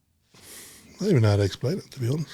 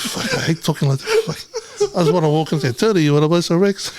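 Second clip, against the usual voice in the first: laughing rant; 6 semitones higher, 4 dB louder than usual. Retold or imitated. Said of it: "I was like".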